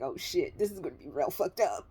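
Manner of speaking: low, hushed dread aside; inner thought. Retold or imitated. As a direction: think it to yourself, barely voiced. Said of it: "I'm thinking in my head".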